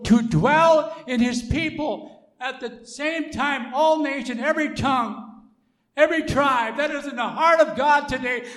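impassioned pulpit crescendo; rising sermon. Acted. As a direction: lift the congregation toward the climax. Preaching at full force.

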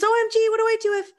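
fast, high, frantic imitation; hammed-up squeal of alarm; mock panic. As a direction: rush it out like a freaked-out commenter. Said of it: "explain to those of you that aren't panicked".

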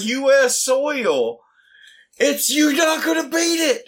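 very high, strained yell of triumph; impassioned crowing. Real, not acted. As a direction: proclaim it high, as unbeatable. Heated defence of favourite film.

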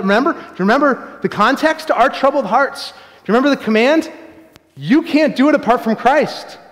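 loud, high-pitched pulpit preaching; preaching at full force. Real, not acted. Pastor urging the congregation toward Christ, full-force preaching. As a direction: drive it home to the congregation.

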